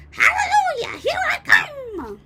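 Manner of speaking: very high, loud whoop of joy; gleeful shout. A narrator's reading. Grandma to George, rising through the floor, whooping. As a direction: crow it out like a wild old woman. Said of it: "she shouted, finding her voice".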